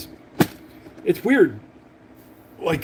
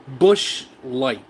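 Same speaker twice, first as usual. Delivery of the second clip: ordinary talk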